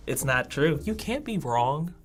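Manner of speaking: ordinary talk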